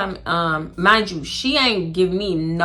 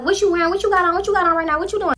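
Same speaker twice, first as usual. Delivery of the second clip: pushy friend; 8 semitones higher, faster than usual. Retold or imitated.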